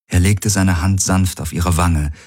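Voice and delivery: usual speaking voice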